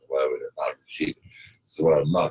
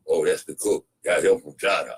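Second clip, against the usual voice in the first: normal talking voice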